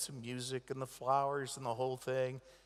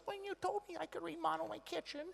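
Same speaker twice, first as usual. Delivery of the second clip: mock mom voice; 13 semitones higher, 8 dB quieter than usual. Mother voiced in story.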